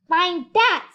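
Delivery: a high, loud sneering bird; sneered. A narrator's reading. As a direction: jeer it out like a snooty bird. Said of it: "sneered the bird".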